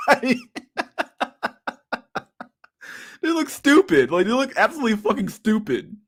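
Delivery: high, helpless laughing words; can barely get it out for laughing. Real, not acted. Cracking up at the picture.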